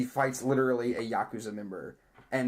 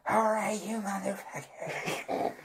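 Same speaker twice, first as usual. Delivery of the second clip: high start, slow drawn-out threat; acted movie challenge; 8 semitones higher, slower than usual. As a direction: play the heroine squaring up to a monster, stretched out. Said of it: "gets in a giant wrench bot and goes".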